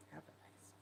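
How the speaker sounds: barely voiced dismissive whisper; brushing off the Cancer man in a whisper. Real, not acted. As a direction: breathe out a mock farewell.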